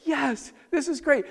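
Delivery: father voiced with sudden delight; excited Jairus. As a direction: sound thrilled and hopeful. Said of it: "He's like".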